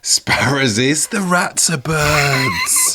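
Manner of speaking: high, slow, drawn-out repeat; mock declaiming. Guest echoes the absurd category, mock declaiming. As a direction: stretch the phrase out theatrically.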